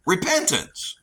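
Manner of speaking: ordinary talk, nothing marked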